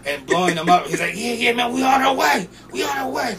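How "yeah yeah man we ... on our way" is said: excited. Retold or imitated. Jack hurrying manager off.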